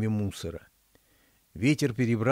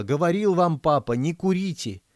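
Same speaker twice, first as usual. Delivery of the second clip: high, loud outburst; shout; 6 semitones higher, 3 dB louder than usual. A narrator's reading. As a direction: blurt it out yelling. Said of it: "закричала".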